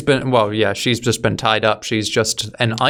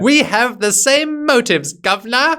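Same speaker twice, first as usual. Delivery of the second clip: higher, louder and slow, a hammy put-on villain voice; hammed-up villain pitch; 9 semitones higher, 3 dB louder than usual. Reviewer mocking the villain inviting Tom to join him, hammy and slow. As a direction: gloat like a cartoon villain recruiting the hero. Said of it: "that tropey scene where he explains".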